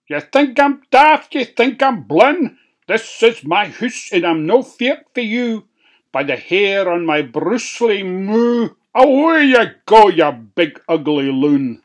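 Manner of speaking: high, loud, defiant pig. A narrator's reading. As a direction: tell the wolf off loudly. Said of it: "pig refuses wolf".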